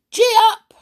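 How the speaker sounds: very high, loud whoop; cried out. Acted. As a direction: urge the goldfish on, yelling. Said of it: "he cried".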